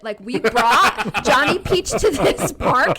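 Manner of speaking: loud, laughing mock scolding; exasperated scolding. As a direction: tell the kid off half laughing. Mom scolds child at park.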